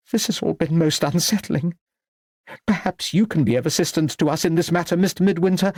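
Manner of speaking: high-pitched, fluttery appeal; unsettled old lady. A narrator's reading. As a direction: appeal for help in a high lady's voice. Unsettled lady asks Anthony for help.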